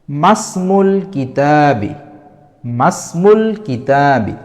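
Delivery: "মাসমুল কিতাবি" drawn out slowly, alif of ইসমুন dropped; slow careful recital. Shown as a demonstration. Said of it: "এখানে উচ্চারণ হবে". (ধীরে স্পষ্ট করে "মাসমুল কিতাবি" উচ্চারণ)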